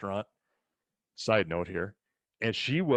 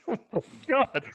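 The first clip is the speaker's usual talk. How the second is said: much higher, louder gasp of disbelief; shocked; 13 semitones higher, 4 dB louder than usual. Retold or imitated. A boy stunned watching the old woman light her own cigarette. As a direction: squeal it in amazed disbelief. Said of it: "I'm like".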